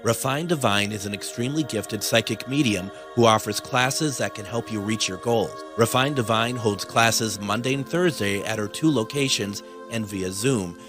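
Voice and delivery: smooth commercial voiceover; ad read. Acted. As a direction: sell it like a radio spot. Recorded ad read.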